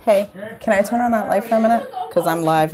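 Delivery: normal talking voice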